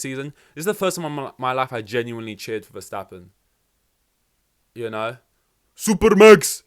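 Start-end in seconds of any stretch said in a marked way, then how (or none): 5.7-6.6 loud, high fan cheer. Retold or imitated. Fan cheering on Verstappen, loud cheer. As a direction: cheer it out at full voice. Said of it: "I was cheering for".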